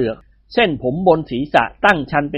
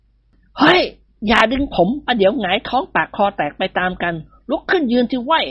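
higher yelp that settles into grumbling; fussy outcry; 6 semitones higher than usual. Acted. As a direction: cry out in protest, then complain. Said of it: "กิมหงวนร้องเอะอะเอะตะโล".

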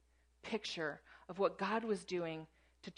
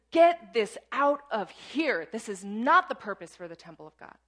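furious shout; 10 dB louder than usual. Acted. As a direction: roar it out, driving them from the temple. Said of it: "he's infuriated".

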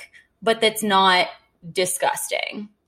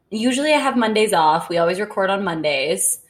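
ordinary talk

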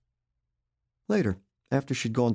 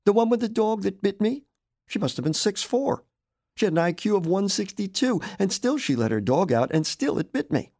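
defensive husband; 10 semitones higher than usual. Acted. Roy argues back about dog.

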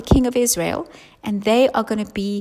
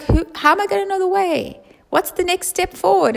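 higher, bewildered questioning; confused disciple; 8 semitones higher than usual. Acted. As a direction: ask in bewildered alarm. Thomas voiced confused.

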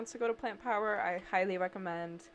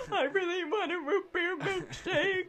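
put-on higher, a touch of a crying child; mock bawling; 9 semitones higher, 4 dB louder than usual. Little girl to her dad, imitating her crying plea. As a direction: whimper it like a little kid in tears. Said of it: "and I start bawling".

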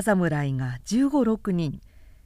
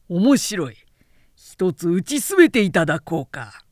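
loud, cold challenge to the samurai; defiant challenge; 2 semitones lower, 6 dB louder than usual. Acted. Tomoemon bursting in on the samurai, loud defiant challenge. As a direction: dare them to try it. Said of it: "と、第四に呼びかけた".

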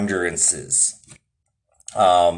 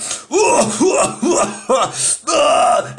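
high, hacking mock cough-laugh; imitated coughing fit; 12 semitones higher than usual. Old man choking on a toke, mock coughing fit. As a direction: act out choking on the smoke. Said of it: "and he starts coughing".

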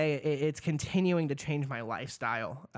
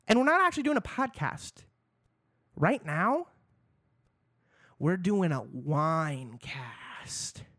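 excited; slower than usual. Acted. Hyped reveal of wine cast.

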